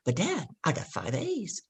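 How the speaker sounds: a soft, slow little plea to her father; pleading child. Retold or imitated. Daughter pleading with her dad over a B, softly and slowly.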